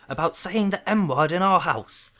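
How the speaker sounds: loud, high, drawn-out outrage; thundering. Acted. Uncle scolding over a forbidden word, thundering. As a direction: thunder each word slowly. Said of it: "thundered his uncle".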